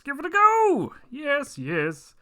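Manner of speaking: booming high villain shout; M. Bison voice. Acted. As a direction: bellow it like a gloating warlord. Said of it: "talking in an M Boy-, Bison voice this week".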